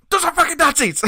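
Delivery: high, loud strained mock shriek; whisper shrieking. Bandmate tells singer about the Nazis, mock whisper-shriek. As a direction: shriek it like a frantic secret. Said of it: "I'm whisper shrieking".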